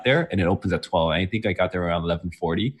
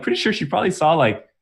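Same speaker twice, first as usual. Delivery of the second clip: normal talking voice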